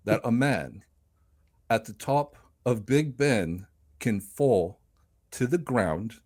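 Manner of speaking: Michael Caine. Shown as a demonstration. Co-host poses a question to the guest, Michael Caine impression. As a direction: imitate a famous British actor, long pauses between phrases. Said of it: "I can do a Michael Caine impression".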